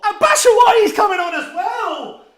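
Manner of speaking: high, loud, drawn-out call; slow, cheering shout at the sub; excited shout. Real, not acted. Fan hails substitute, shouting.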